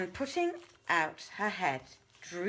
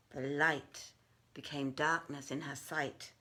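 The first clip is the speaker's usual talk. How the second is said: usual speaking voice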